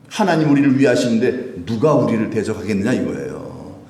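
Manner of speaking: ordinary talk, nothing marked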